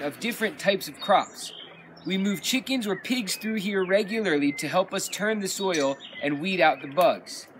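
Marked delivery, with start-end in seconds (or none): none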